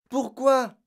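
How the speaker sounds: high, loud demand; girl shouting in dismay; urgent cry. A narrator's reading. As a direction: cry out for a reason. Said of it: "cria Mathilda".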